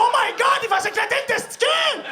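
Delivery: high panicked cry; horrified parents yelling; alarmed shout. Acted.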